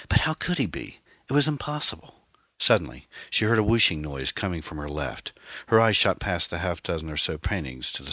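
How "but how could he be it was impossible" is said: high-pitched, incredulous inner voice; stunned disbelief. A narrator's reading. Anne reels at the killer's true identity, incredulous. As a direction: pitch it up, refusing to believe.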